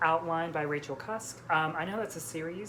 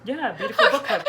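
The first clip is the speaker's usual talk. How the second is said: laughing; 9 semitones higher, 10 dB louder, faster than usual. Real, not acted. Laughing at friend's confusion.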